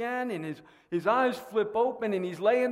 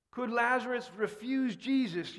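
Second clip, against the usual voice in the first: ordinary delivery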